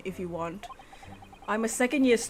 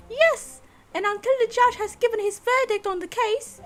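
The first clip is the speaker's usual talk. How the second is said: much higher, firm lady's voice; prim assistant voice; 10 semitones higher, 5 dB louder than usual. Acted. Assistant defends her client to the court, high firm voice. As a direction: stand up for the client, polite but firm.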